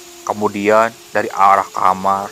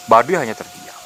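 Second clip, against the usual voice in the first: ordinary delivery, nothing marked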